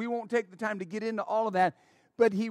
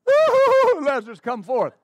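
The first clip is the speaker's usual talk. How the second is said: high, loud hammed-up wail, then plain call; mock-sob, then call Lazarus out; mock weeping Jesus; 4 semitones higher, 7 dB louder than usual. Pastor mocking the idea of Jesus crying, hammed-up wail. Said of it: "ridiculous to cry over somebody".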